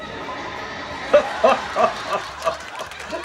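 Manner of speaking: a burst of breathy real laughter. Real, not acted. Reacting to a funny clip.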